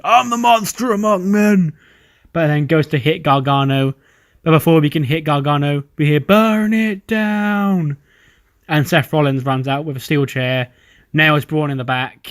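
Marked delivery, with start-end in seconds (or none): 0.0-1.7 high, strained mock wrestler's yell; imitated scream. Braun in Gargano's face, imitated scream. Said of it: "scream in his face like". 6.2-8.0 high, long-drawn mock yell; imitated entrance cry. Theme hits before Braun can strike, drawn-out imitated cry.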